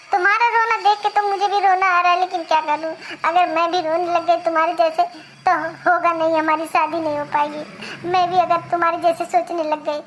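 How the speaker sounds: a man lightly voicing the bride, pleading; a lightly voiced bride pleading with her jilted lover; woman's voice. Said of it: "अरे लबेदू".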